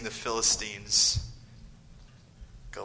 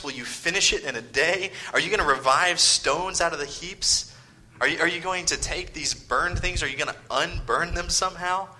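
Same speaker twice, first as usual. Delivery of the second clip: higher, mocking questions thrown at the exiles; scornful taunting; 5 semitones higher, 5 dB louder than usual. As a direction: taunt them like the enemy would. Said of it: "He, he raises up".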